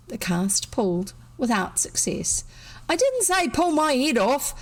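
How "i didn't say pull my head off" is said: high, cutting complaint; stern sarcasm. A narrator's reading. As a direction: snap it sarcastically. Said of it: "said the stern, sarcastic voice".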